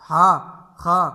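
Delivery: ہا and خا on open "aa"; correct open vowel. Shown as a demonstration. Teacher models the letters, loud and open. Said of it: "مجہول طریقے سے ہم نے ان کو نکال کے معروف".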